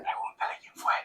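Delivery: unvoiced-sounding conspiratorial whisper; barely audible furtive prompt; secretive whisper. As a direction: whisper it to the person beside you. [name] secretly prompting Juan at table.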